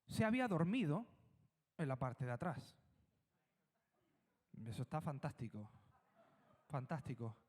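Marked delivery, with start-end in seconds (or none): 4.7-7.4 low, dry sarcastic praise; deadpan irony, not meant; quiet mock-approving aside. Sarcasm at sleeping Jesus.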